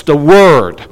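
ordinary delivery

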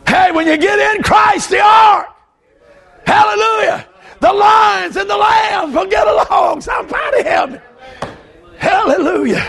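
high, shouted revival preaching; preaching at full force. Real, not acted.

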